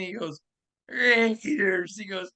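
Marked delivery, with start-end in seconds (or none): 0.9-2.0 stretched-out, higher-pitched one-word greeting; Al Davis impression. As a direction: imitate his habitual greeting, drawn out high. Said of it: "does the same thing".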